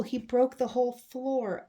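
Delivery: ordinary delivery, nothing marked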